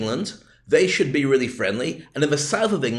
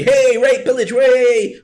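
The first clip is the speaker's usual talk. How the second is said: higher, louder, rowdy battle cry; rowdy Viking whoop; 8 semitones higher, 7 dB louder, much slower than usual. Retold or imitated. A rowdy Viking cheering on his raid, loud and wild. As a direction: bellow like a marauding Viking.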